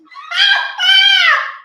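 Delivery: loud delighted shriek of laughter; big laugh. Real, not acted. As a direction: burst out laughing. Big laugh on live show.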